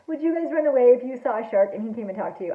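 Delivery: ordinary delivery, nothing marked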